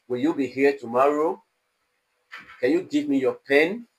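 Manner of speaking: questions climbing on 'tomorrow' and 'pen'; rising intonation. Shown as a demonstration. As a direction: lift 'tomorrow' and 'pen' to ask yes or no. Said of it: "rising intonation".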